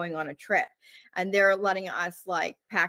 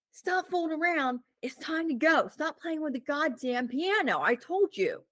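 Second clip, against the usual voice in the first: a pitched-up, scolding dad voice; irritated dad; 8 semitones higher than usual. Retold or imitated. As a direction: hurry the kids out, fed up. Dad scolding kids to leave.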